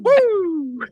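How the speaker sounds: high, loud Ric Flair woo. Retold or imitated. Answering the Roadrunner pitch with a loud, high Ric Flair woo. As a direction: belt a Nature Boy woo. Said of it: "That's not bad".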